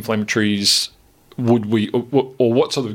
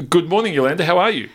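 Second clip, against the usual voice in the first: bright curious science host; 6 semitones higher than usual. Real, not acted. Host greets new studio guest.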